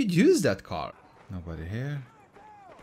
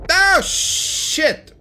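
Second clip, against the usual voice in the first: high, loud startled curse; alarmed cursing; 10 semitones higher, 7 dB louder than usual. Real, not acted. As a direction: blurt it out in dismay. Threw the wrong grenade.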